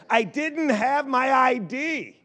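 grandma's excuse. Retold or imitated. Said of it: "I heard her say".

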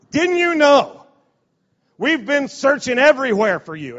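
high, loud frantic mother's cry; frantic mom. Retold or imitated. A mother scolding her lost boy, frantic and loud. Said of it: "she's just a mom".

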